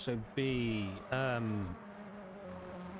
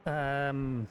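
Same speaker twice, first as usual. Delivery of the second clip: race commentary; 4 semitones higher than usual. Acted.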